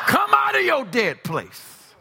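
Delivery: high, slow preacher's call; commanding summons. Real, not acted. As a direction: call them out with drawn-out force.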